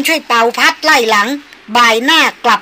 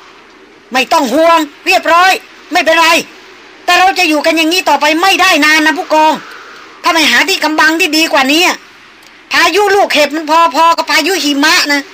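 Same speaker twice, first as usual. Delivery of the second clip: loud, high shout against the wind; shouting over storm; 6 semitones higher, 4 dB louder than usual. A narrator's reading. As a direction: yell to be heard over the hail. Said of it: "เสียงท่านหัวหน้าคณะตะโกนแข่งพายุ".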